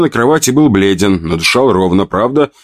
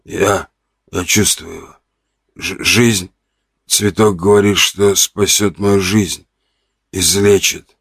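weak hoarse. Acted. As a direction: barely get it out. Weak patient voiced.

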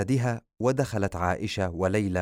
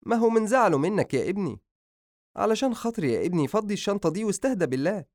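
high, coaxing mother's voice; mother calming her angry son; pleading mother; 11 semitones higher, faster than usual. Acted. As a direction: beg him gently to unpack.

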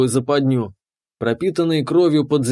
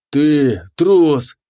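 loud bellowing accusation; roaring monster; 4 dB louder than usual. A narrator's reading. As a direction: bellow it like an enraged beast. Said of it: "взревел монстр".

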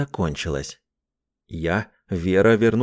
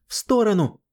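high urgent warning cry; desperate shout; 11 semitones higher than usual. A narrator's reading. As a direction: scream the warning in panic. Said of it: "отчаянный женский крик".

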